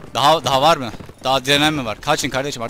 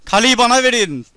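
higher, louder shout for the castle; exasperated high yell; high shouted demand; 8 semitones higher, 6 dB louder than usual. Acted.

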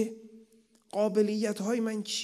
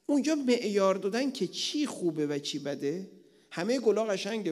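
normal talking voice

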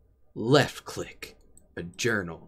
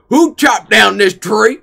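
outraged shout; 8 semitones higher, 11 dB louder than usual. Acted. Outraged at fallen tree.

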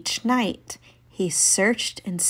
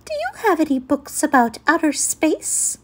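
bird voice; 7 semitones higher than usual. Acted. Bird asks for books.

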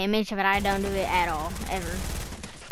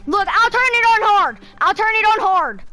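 excited; 9 semitones higher, 6 dB louder than usual. Real, not acted. Showing off the hack.

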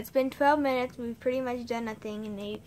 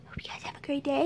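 whispered goodbye; 3 semitones higher, faster than usual. Acted. Whispering goodbye to the vlog viewers. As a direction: breathe the goodbye.

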